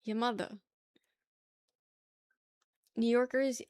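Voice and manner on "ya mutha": New York "ya mutha", open A ending; New York accent. Shown as a demonstration. Streamer showing how you say it, New York accent. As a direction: drop both Rs like a New Yorker. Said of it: "That's just how you say it".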